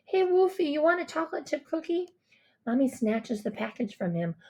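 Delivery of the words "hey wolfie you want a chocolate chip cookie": put-on high, childlike little-boy voice; little brother. A narrator's reading. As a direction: offer it like an eager small kid. Little brother offers a cookie.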